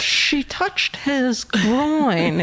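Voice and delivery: very slow, odd reading voice; nerdy killer voice. Pretend. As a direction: read it like a creepy nerd. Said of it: "kind of nerdy voice like that".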